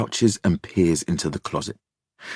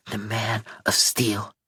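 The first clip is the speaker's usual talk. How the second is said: put-on quieter, slower child's murmur; soft repeat; 2 semitones higher, 5 dB quieter than usual. A narrator's reading. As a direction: say it softly to yourself for courage. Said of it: "he repeats".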